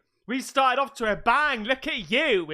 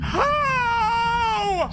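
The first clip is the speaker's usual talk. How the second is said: startled cry; 11 semitones higher, 5 dB louder than usual. Real, not acted. Startled by something on screen.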